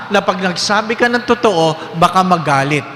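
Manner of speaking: ordinary delivery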